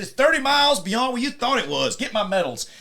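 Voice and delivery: high, loud retold rant ending in an order; berating outburst. General excited by the breakthrough, loudly ordering his medals. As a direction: rant like an exasperated general, then give the order.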